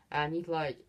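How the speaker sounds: ordinary delivery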